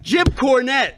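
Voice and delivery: Jim Cornette. Acted. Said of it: "he reminds me of Jim Cornette".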